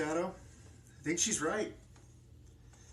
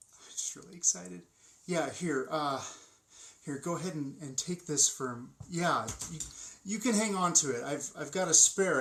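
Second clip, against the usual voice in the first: normal talking voice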